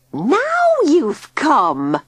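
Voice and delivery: high, slow, drawn-out screech. A narrator's reading. Old woman Tilly screeching at Digby at the door. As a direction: screech the greeting at him. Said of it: "screeched Tilly in greeting".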